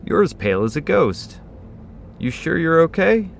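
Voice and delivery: loud, high-pitched voice of concern; high, concerned questioning; concerned customer. A narrator's reading. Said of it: "the concern written across his face".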